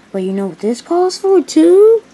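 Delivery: "too" swooping up high and loud; loud, rising lead-in ending on "too"; teasing build-up. Acted. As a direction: build to a big questioning "too?". Sets up a montage joke.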